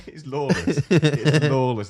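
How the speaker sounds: loud, slow gleeful shout of lawless; real delight, loud and drawn out; delighted exclaim. Real, not acted. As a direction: belt it out in delight. Emphatic exclaim.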